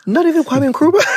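high, incredulous cry breaking into laughter; laughing disbelief. Real, not acted. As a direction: crack up as you exclaim it.

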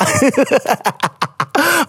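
long, high chuckling laugh; real laughter. Real, not acted. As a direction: laugh helplessly at a funny name. Laughs at the letter writer's name.

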